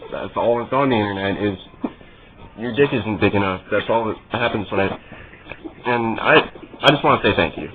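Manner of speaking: normal talking voice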